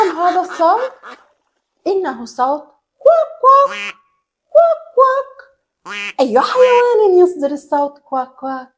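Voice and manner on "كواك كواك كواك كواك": high, loud quacking like a duck. Shown as a demonstration. Teacher quacking for the children, high and loud.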